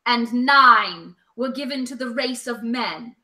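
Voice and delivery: slow, low, solemn elven narration; Galadriel as Cate Blanchett. Acted. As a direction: intone it like a grave ancient prophecy, slowly. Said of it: "do this in my Cate Blanchett Galadriel voice".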